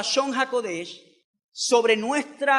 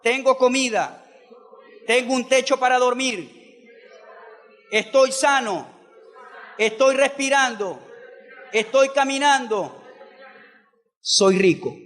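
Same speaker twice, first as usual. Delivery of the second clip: cada frase separada por pausas, voz firme; lento, marcado. Shown as a demonstration. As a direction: dilo despacio, que la congregación repita. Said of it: "Repita conmigo". (frases lentas y firmes para que repitan)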